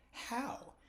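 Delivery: high, stunned how; incredulous how. Retold or imitated. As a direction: ask it in total disbelief. Retelling his disbelief.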